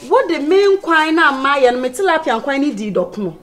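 loud, heated telling-off; angry scolding. Acted. Scolding Mary over the tilapia soup, angry and loud. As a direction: lay into the culprit loudly.

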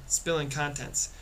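ordinary delivery, nothing marked

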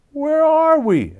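worried question. A narrator's reading. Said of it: "asked Arnold".

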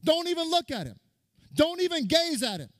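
high, barking drill sergeant yell at recruits; screaming drill sergeant; drill instructor yelling. Retold or imitated. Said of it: "he told the platoon".

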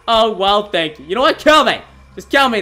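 ordinary talk, nothing marked

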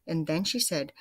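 ordinary delivery, nothing marked

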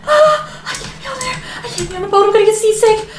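high, gasping panic; panicked whining. Acted. Mother stuck on the boat, high whining panic. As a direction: work yourself into a frantic whimper.

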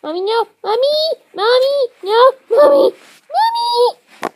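high, loud cries for Mommy; panicked child calling. Acted. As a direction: scream for Mommy with rising pitch. Child hamster screams for Mommy.

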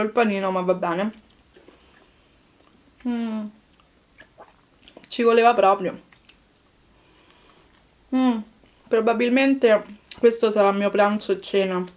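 chewing while talking; speaking between bites of burger; mouth full of food. Real, not acted.

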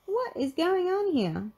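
high, baffled question; high-pitched bewildered cry; Velma crying out. Acted. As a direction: ask it high, in alarm. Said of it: "cried Velma".

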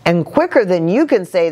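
ordinary delivery

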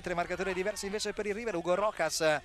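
ordinary talk

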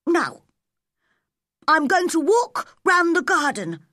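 put-on high-pitched boy announcing his plan; high, determined character voice; Colin. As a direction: declare it in a young boy's high voice. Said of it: "he said".

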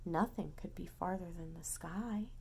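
sleepy hushed narration; soft unvoiced storytelling; whisper. A narrator's reading.

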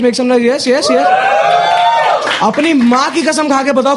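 usual speaking voice

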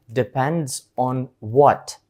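slow, deliberate English model; slow model phrase. Shown as a demonstration. Teacher models the follow-up question slowly. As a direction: drag it out clearly for learners. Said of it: "в українській ми кажемо".

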